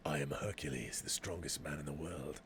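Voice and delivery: very low, hushed heroic declaration; Hercules. Acted. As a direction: intone it softly and deep in an accented hero's voice. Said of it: "how Hercules would speak".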